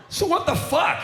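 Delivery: high, baffled what-the-hell; mock stunned question; mock bewildered. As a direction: ask it in a squeaky stunned voice. Comic retells backstage chat.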